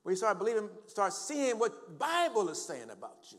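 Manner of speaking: high, loud preacher's cry; impassioned pulpit shouting; full-force preaching. Real, not acted.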